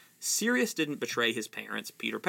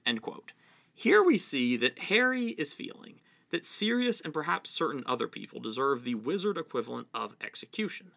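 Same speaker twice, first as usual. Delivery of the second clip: ordinary delivery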